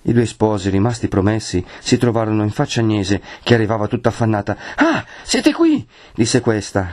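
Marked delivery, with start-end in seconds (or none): 4.8-5.9 high, slow, effortful exclamation; breathless, words forced out. A narrator's reading. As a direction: get the words out with difficulty after running. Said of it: "cavando fuori la parola a stento". (voce affannata, parole a stento)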